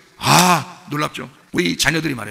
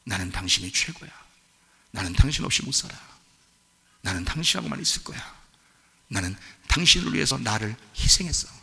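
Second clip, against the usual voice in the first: soft devotion; 4 semitones lower, 6 dB quieter than usual. Retold or imitated. Voicing love words to wife.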